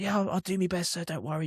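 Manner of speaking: disarm him with gentle meekness; innocent. Retold or imitated. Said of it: "in a real innocent way".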